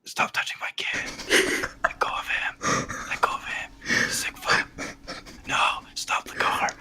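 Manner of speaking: panicked unvoiced whisper; frantic whispered pleading; whispered asmr role play. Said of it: "some A S M R roleplay".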